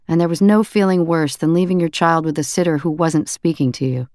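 usual speaking voice

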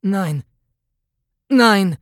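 starving inmate shouting to be understood; desperate shout. A narrator's reading. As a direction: shout with all the strength left. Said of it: "the inmate shouted".

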